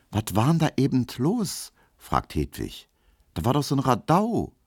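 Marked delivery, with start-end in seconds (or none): 0.1-1.7 high-pitched Berlin question; curious girl. Acted. Hedwig asks about the row. 3.3-4.5 high-pitched Berlin curiosity; curious girl. Acted. Hedwig asks about the row.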